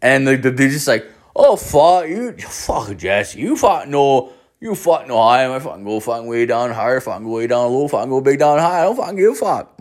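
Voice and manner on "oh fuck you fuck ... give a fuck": put-on higher, fast, mumbled Newfoundland accent; thick Newfoundland accent. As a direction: rattle it off in a thick Newfoundland accent, swearing every word. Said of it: "he just said that".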